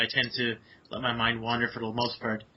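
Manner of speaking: ordinary delivery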